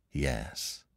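usual speaking voice

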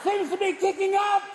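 high, strained shout over wind; storm reporter. Acted. Reporter on the coast answering the anchor, shouting over the storm. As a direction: holler a report from inside the storm. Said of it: "in the middle of the storm going".

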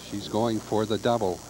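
ordinary talk

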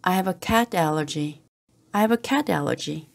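ordinary talk

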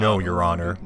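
lightly voiced stiff witness reply; mock defendant voice. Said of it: "It's just like".

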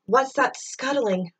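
usual speaking voice

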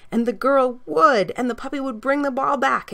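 usual speaking voice

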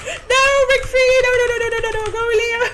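high, loud panicked yelling; panicked shout. Real, not acted. Player yelling as enemies grab her, panicked. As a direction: scream to break free. Enemy grabs her in game.

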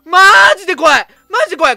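loud frightened cry; scared cry. Real, not acted. Scared in horror game.

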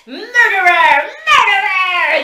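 high, loud, slow crying snarl; raging creature snarl; snarling dragon. A narrator's reading. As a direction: snarl it with every strike. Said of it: "the drakeling snarls".

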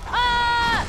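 loud, high cry; startled yell. Real, not acted. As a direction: shout out at a sudden death. Reacts to a game death.

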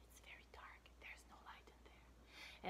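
hushed dark warning; spooky whisper. Acted. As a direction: whisper it like a ghost story. Spooky hush to children.

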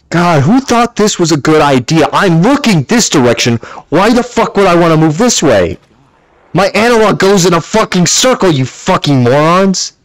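high, annoyed tirade at the game's design; exasperated rant. Real, not acted. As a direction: vent at the developers. Ranting at VR controls.

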